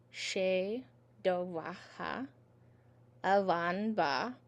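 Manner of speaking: very slow, measured Irish syllables; nice and slow. Shown as a demonstration. Teacher saying the first verse for the class, nice and slow. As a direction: draw out each syllable for learners to follow. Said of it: "say nice and slow the first verse".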